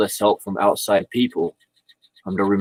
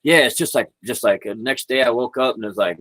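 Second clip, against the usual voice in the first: ordinary delivery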